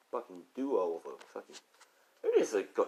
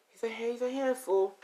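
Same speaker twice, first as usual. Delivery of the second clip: high-pitched, chatty exasperation; chatty mother. Acted. Mother telling a friend about Zeus, high chatty voice. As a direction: tell a friend in a raised voice.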